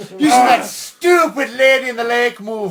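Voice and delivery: slow, loud shouted cop rant; comic cop fury; angry rant. Acted. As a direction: spit out the grudge loudly. Cop rants about move.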